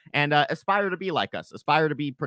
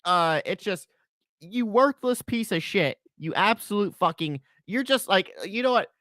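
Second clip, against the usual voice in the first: contemptuous rant; 7 semitones higher, 8 dB louder than usual. Acted. Ranting insult at Jared.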